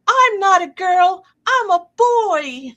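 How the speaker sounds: high, loud, slow silly shout; husband's hammed-up high-pitched proclamation; playful announcement. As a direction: burst out of the box with a goofy high cry. Husband jumps out in costume.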